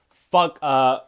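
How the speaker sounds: loud, sharp high curse; frustrated curse. Real, not acted. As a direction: spit it out in annoyance. Reacting to a hated fighter.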